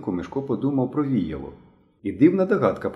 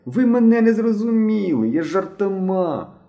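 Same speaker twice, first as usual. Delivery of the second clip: very high wailing plea; drawn-out, whiny protest; tearful cry; 11 semitones higher, 4 dB louder, slower than usual. A narrator's reading. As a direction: wail it out like a boy near tears. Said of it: "плачучим голосом закричав Генка".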